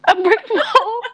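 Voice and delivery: high, loud disbelieving echo; incredulous. Real, not acted. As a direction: repeat it in disbelief. Mocking the misread word.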